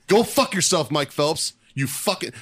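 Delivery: real public callout. Real, not acted. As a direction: yell it at him for real. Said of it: "So I will say it right now".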